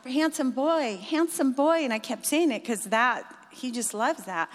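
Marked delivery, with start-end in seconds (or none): 0.0-1.8 raised, pleading call; calling out to the dog. Retold or imitated. Marcy calling her dog at the fence, raised pleading call. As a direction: call him sweetly but urgently, a little louder.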